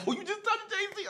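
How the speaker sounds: very high, laughing squeal of disbelief; the boys teasing him, squealing with laughter; laughing friends. Retold or imitated. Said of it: "all my boys was like".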